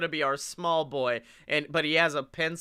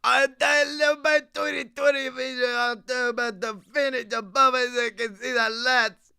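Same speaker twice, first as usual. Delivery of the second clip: higher, louder hammed Cockney rant; 7 semitones higher, 3 dB louder than usual. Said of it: "over Cockney".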